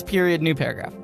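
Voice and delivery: ordinary talk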